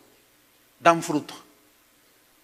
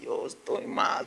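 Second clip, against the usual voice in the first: whispered confession; 8 semitones lower, 9 dB quieter, slower than usual. Acted. Quiet confession in a prayer.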